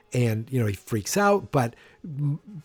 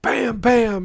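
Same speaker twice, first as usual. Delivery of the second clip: loud, high mock gunshots "Bam, bam"; gunshot noises; 10 semitones higher, 5 dB louder than usual. Biff shoots George: loud mock gunshots. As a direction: snap out two shots.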